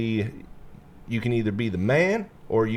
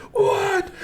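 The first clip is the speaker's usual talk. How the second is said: high, louder shocked cry; team shocked; 4 dB louder than usual. Retold or imitated. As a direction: blurt it in mock disbelief. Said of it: "all of us are like".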